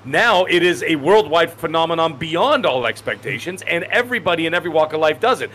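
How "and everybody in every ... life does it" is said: ordinary talk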